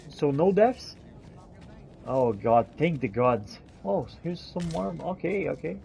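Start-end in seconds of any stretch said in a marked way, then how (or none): none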